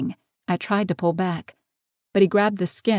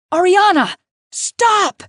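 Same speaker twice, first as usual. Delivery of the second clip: high, loud, commanding shout; urgent shouted order, pitched way up; yelling; 13 semitones higher, 9 dB louder, slower than usual. A narrator's reading. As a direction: yell after someone running away. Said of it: "Maximus yelled".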